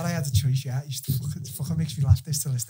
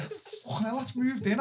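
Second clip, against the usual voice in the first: high mock alarm; 9 semitones higher, slower than usual. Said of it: "I was like".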